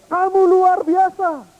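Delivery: high, loud, slow call; child calling praise. Pretend. Boy calling praise to the hills, high and slow. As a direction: stretch it out across the hills.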